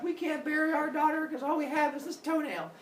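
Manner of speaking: lightly voiced worried parents; grieving parents. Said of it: "Mama and Papa Macbeth are like".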